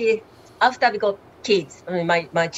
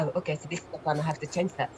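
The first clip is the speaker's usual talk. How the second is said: quiet inner thought; 6 semitones lower, 11 dB quieter, much faster than usual. Retold or imitated. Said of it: "inner thought retold".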